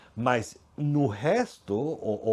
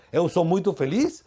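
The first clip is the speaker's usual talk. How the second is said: higher, louder imitation of a happy post; mock happy declaration; 5 semitones higher, 7 dB louder than usual. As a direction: proclaim it like a social media boast. Acted social media happiness claim. (declaração de felicidade encenada)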